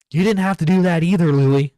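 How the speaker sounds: loud exasperated telling-off; scolding shout. Real, not acted. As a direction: fire it off at full volume. Heated scolding of the video maker.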